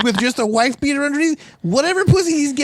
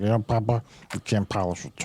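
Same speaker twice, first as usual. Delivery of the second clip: very low, quiet grumble; mock gruff guy, sunk low and soft; Darnell; 13 semitones lower, 8 dB quieter than usual. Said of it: "he was telling that to Papa".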